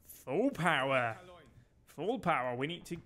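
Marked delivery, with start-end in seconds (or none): 0.1-1.2 slow, commanding order to the crew; drawn-out captain's order. Acted. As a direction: stretch it out like a sub captain barking orders. Captain orders full speed.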